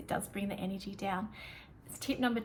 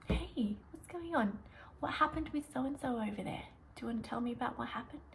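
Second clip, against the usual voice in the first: hushed, gentle and soft; calm, soft voice; 6 dB quieter than usual. Shown as a demonstration. Teacher softly asking an upset student what happened. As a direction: settle them with a near whisper. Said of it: "Just deliver it in that way".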